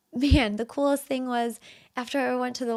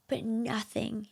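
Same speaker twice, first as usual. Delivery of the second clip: whisper; 7 dB quieter, slower than usual. Real, not acted. Emotional point about her children.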